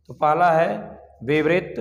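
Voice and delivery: ordinary talk, nothing marked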